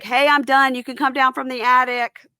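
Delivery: shouted loud and high up the stairs; yelling up. Retold or imitated. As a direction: call up to the attic. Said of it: "he yells up".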